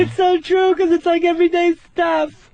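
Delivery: man doing a woman's voice. Retold or imitated.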